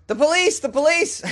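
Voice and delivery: high squawking parrot cry; shrill bird alarm call. Retold or imitated. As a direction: screech it like a parrot. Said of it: "The parrot shouts".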